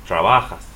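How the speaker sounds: "trabajas" as tra-BA-has; Spanish "trabajas", middle syllable stressed; stress next-to-last syllable. Shown as a demonstration. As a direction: punch "ba" in "trabajas". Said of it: "next to the last syllable".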